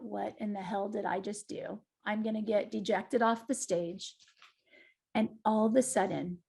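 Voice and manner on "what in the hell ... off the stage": much softer, a worried inner thought; hushed inner panic. Retold or imitated. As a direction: let it drop to a low private murmur of dread. Panicked inner thought, room silent.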